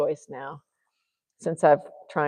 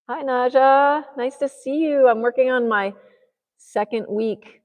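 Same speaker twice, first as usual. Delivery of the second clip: high, louder cooing voice; talking to Naja; 8 semitones higher, 6 dB louder than usual. Real, not acted. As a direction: coo sweetly at a pet. Talks sweetly to Naja.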